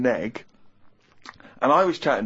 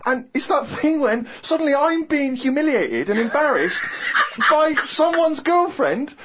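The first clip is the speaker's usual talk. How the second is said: indignant rant; 13 semitones higher than usual. Retold or imitated. Ranting about being humiliated.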